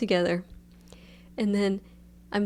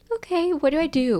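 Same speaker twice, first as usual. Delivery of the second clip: high anxious; 9 semitones higher, 3 dB louder than usual. Retold or imitated. Her own panicked quote.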